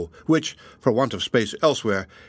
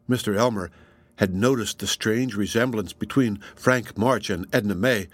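ordinary delivery

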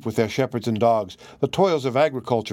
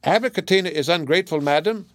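high, quick chattering parrot; 6 semitones higher than usual. Acted. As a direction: tattle like a pet bird. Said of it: "continued to chatter as he had begun".

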